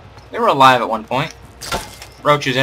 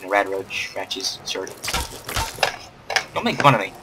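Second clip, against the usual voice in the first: ordinary talk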